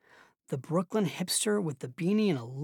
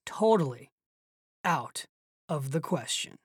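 slow musing; 3 semitones lower than usual. A narrator's reading. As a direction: repeat it slowly, word by word. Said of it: "speaking slowly, as if musing over the words".

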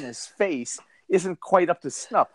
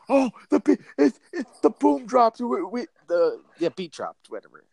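stoned raver; 5 semitones higher than usual. Retold or imitated. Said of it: "They're like".

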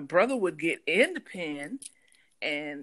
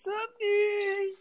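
calling out; 11 semitones higher than usual. Retold or imitated. Brother calling for lost pig.

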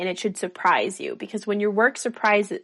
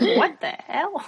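higher, slow, laughing bewilderment; baffled laugh; 9 semitones higher, slower than usual. Retold or imitated. Waking beside a strange spouse, baffled laughing. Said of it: "And be like".

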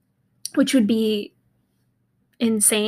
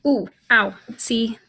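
normal talking voice

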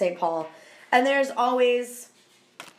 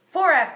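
FOREVER said high and loud; stressed forever; 5 semitones higher, 6 dB louder than usual. Real, not acted. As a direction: stretch out Forever emphatically. Exaggerating how long.

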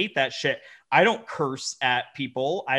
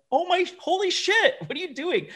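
much higher, incredulous mock shock; mock disbelief. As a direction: act floored by his answer. Retold reaction to client's reply.

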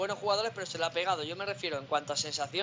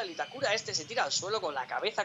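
ordinary talk, nothing marked

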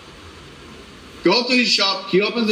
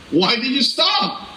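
questioning wife; 6 semitones higher, slower than usual. Retold or imitated. Retold wife waking in car.

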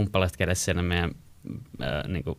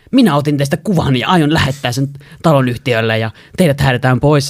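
loud, higher threatening shout; angry threat; 6 semitones higher, 9 dB louder than usual. Retold or imitated. Neighbour threatening to report them to the housing company, angry shout. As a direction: threaten eviction at full volume. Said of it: "sit se alkaa huutaa vaan".

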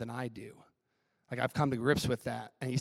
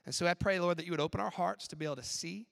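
prayer; 5 dB quieter than usual. Acted. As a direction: pray gently over the room. Closing prayer.

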